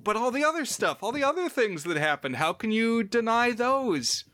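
high-pitched, hurried mock protest; Pascoe protesting. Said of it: "but Pascoe says".

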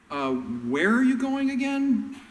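high, slow, baffled question; confused disciple. Retold or imitated. Peter and Thomas asking Jesus, high and baffled. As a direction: play dumb, lost and puzzled. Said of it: "they're like".

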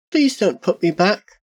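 put-on high, small voice of a pleading child; tiny high plea, fully voiced; small frightened child. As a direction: plead like a scared little girl. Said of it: "Her voice was the smallest whisper".

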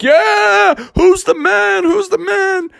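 very high, crowing cheer; triumphant boasting. Acted. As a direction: crow to the crowd like a winner. Bear boasts to cheering crowd.

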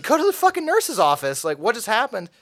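high, urgent teacher voice; alarmed teacher. Retold or imitated. Teacher sending the bloodied teen to the nurse, alarmed. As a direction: send him off in a panic.

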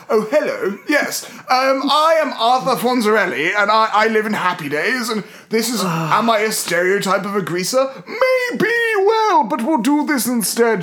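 hammed-up posh British accent; exaggerated posh British. Host playing Fonzarelli as posh Briton, hammed-up posh accent. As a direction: put on an extra-posh British voice, swooping upward. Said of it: "I will go more posh than currently".

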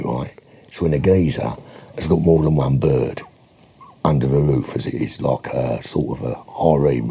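low-pitched, matey Cockney pitch; Cockney film director. Acted. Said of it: "Can you do your Cockney film director?".

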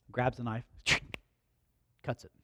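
normal talking voice

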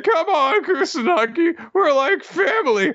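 high, wheedling pleading voice; groveling whiny voice. Retold or imitated. Dosan pleading with Kusanagi to spare him, high whiny groveling. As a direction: beg pathetically like a cornered villain.

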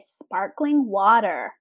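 "sparkling water" with hard American r's; American accent. Shown as a demonstration. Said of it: "trying to say it in our accent".

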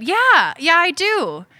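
high-pitched, falling yeahs; flustered eager reply. Retold or imitated. Shopper answering the attractive Swedish cashier, high and flustered.

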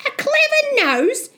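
very high questioning rise; incredulous echo. Acted. Mother doubting her son's story, high incredulous question. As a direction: throw it back as a high, disbelieving question. Mother echoes Jack in disbelief.

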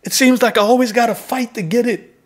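impassioned preaching. Acted.

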